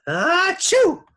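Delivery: high, explosive achoo; huge sneeze. A narrator's reading. Teacher with pepper in his face, explosive sneeze. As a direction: build up and blast out a sneeze. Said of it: "He sneezed".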